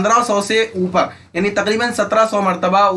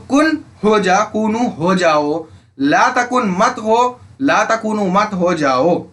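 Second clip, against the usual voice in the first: slow drill; slower than usual. Shown as a demonstration. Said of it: "teacher drilling the forms slowly".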